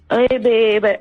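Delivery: usual speaking voice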